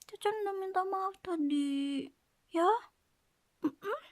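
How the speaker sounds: usual speaking voice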